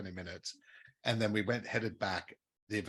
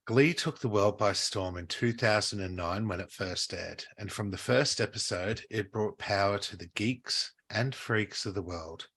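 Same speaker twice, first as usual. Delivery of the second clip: louder, slow announcer read; show intro read; 5 dB louder than usual. Acted. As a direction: open the show like a presenter.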